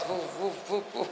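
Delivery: soft repeated traffic whooshes; quiet "foof" noises for passing traffic; imitated passing cars. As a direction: mimic cars whooshing past with your voice. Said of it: "وأنت على الشارع والسيارات".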